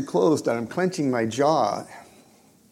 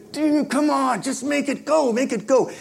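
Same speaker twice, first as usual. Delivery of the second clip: very high, strained urging; acted frantic yell; 12 semitones higher, 4 dB louder than usual. Trapped inside, urging his praying friend, frantic high yell. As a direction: plead at a high pitch, straining to get it out. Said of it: "I, I wanna yell".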